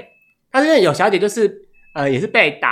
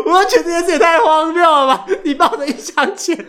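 laughing; 11 semitones higher than usual. Real, not acted. Cracking up at absurd story.

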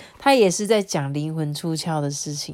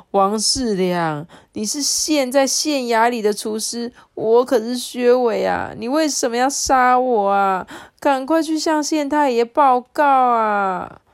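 拉长名字，大声急切地喊; 刀下拼命求饶; 哀求喊叫; 6 semitones higher, 5 dB louder than usual. A narrator's reading. 快被杀时向厨师求救. (薛维对厨师王世良大声急切求救)

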